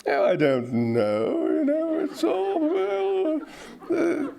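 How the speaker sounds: condescending English, swooping up very high; hammed-up snobbish English dithering; English snobbery. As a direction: look down your nose, voice climbing high. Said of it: "a certain snobbery".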